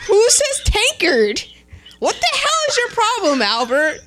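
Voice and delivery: high-pitched, exasperated rant; hammed-up exasperation; mock outrage. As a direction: play up baffled indignation at a friend. Acted quarrel with Albert.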